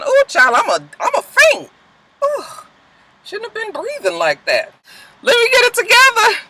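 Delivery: high, gasping mock swoon; hammed-up fainting, catching her breath; breathless faint. Commenting on the show, she hams up a faint. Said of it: "that's tiring for the voice".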